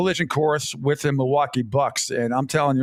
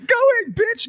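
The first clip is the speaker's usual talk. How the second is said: imitating the clip, much higher and squeaky; mock announcer yell; 3 dB louder than usual. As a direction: echo the clip's cry in a high, hammed-up voice. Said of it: "you get the idea".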